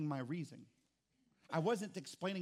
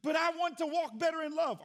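much higher, loud whiny plea; a hammed-up whine of wanting more; mock pleading believer; 13 semitones higher, 8 dB louder than usual. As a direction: play the believer begging God.

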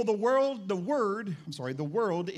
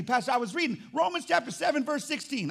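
higher-pitched, excited mock congregant; eager church member; 8 semitones higher, 4 dB louder than usual. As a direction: quiz the pastor eagerly, pitched up.

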